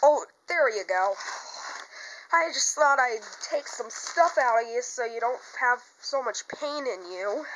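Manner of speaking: ordinary delivery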